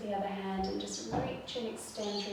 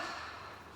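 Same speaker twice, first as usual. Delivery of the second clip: audible exhale sigh; 11 dB quieter than usual. Shown as a demonstration. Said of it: "all the way out".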